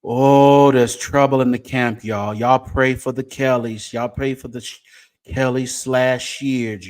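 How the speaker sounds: normal talking voice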